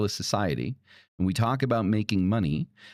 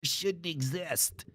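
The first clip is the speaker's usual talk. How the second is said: much higher, much softer and slowed whiny mimicry; mocking quote; 8 semitones higher, 10 dB quieter, slower than usual. Mocking the eat-the-rich crowd with a soft, high whine. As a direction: mimic them as limp, whining complainers. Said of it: "people that are like".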